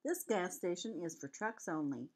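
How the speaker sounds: ordinary delivery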